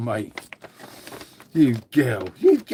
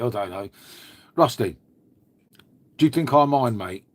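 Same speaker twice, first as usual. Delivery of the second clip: ordinary delivery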